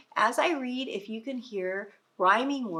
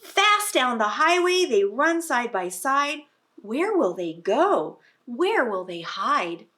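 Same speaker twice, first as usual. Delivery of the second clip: higher, louder urgent read; excited storybook reading; 4 semitones higher, 8 dB louder than usual. Acted. Reading picture book to children.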